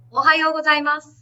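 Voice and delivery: bright high-pitched ohayou gozaimasu; high pitch greeting. Shown as a demonstration. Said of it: "in a high pitch".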